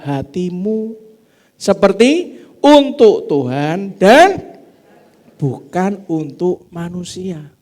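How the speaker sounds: slow, deliberate Bible recitation; scripture reading. Acted. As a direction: read each phrase out for the congregation. Reads scripture aloud slowly.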